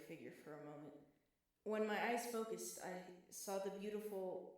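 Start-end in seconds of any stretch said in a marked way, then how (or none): none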